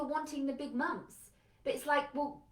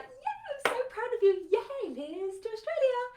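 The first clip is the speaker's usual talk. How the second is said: much higher, loud, sing-song hollow praise; fake proud cheer; 13 semitones higher, 5 dB louder than usual. Parent to Liz leaving for Australia, fake cheerful send-off. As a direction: cheer them on while secretly against it.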